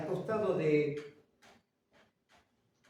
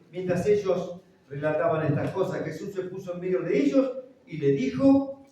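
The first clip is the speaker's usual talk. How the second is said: forceful reading; 2 semitones higher, 7 dB louder than usual. Real, not acted.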